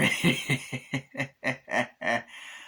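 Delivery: long staccato mock cackle; imitated cackle. Chucky caught by the photographer, imitated staccato cackle. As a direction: do a villain doll's chuckle in separate bursts.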